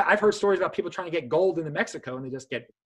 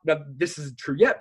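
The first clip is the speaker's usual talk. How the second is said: usual speaking voice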